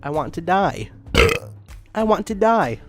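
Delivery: normal talking voice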